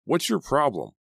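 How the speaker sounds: loud, sharp demand. Acted. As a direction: stand up to him. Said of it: "Jake demanded".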